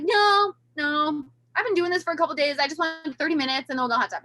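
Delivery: emphatic mock protest; firm refusal. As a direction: push back hard on the husband. Refusing husband in the shower.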